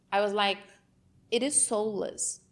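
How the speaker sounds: ordinary delivery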